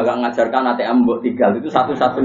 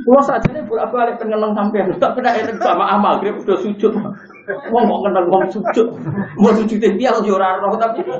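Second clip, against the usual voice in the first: laughing confession; 9 semitones higher than usual. Real, not acted. Confessing own pride, laughing.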